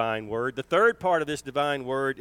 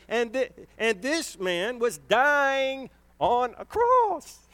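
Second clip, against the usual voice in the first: high, slow impassioned preacher's cry; preaching at full force; 10 semitones higher, slower than usual. Acted. Preacher crying out the thief's plea to the congregation. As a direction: lift the dying man's plea up high and slow.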